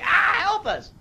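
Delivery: high-pitched cry for help, acted out; mock scream. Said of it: "and we're just screaming".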